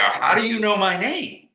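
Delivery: retold wide-eyed astonishment; surprised. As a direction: sound genuinely baffled. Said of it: "And I go".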